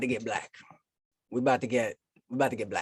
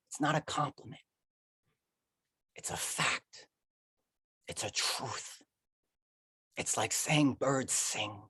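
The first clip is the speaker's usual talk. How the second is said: hushed poem; 6 dB quieter, slower than usual. Acted. Poet insisting to a sweetheart it's true, hushed. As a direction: let the lines drop slowly to a hush. Poem drops to near whisper.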